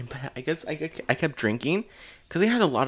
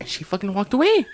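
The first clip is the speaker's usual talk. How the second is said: normal talking voice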